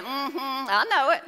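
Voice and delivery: slow, lightly put-on mumbling yes-man; lightly voiced Aaron going along; mock meek agreement. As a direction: drawl a timid agreement. Mocking passive Aaron.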